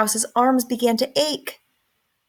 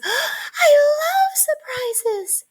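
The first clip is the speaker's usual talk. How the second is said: very high, slow delighted squeal; high, drawn-out mouse squeal of delight; excited squeak; much slower than usual. A narrator's reading. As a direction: squeal it like a thrilled little mouse. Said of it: "squeaked Mouse".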